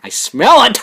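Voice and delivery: high, loud accusing outburst; mock cop accusation. Pretend cop sniffing out drink, loud high accusation. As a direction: pounce on the suspect with a mock accusation.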